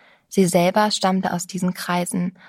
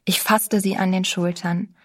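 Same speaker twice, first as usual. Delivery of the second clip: ordinary delivery, nothing marked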